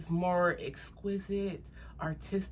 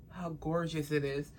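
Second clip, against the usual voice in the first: ordinary talk, nothing marked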